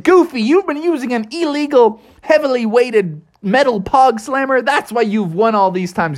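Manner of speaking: high, indignant accusation; accusing friends. A narrator's reading. Friends catch Goofy cheating, high indignant voice.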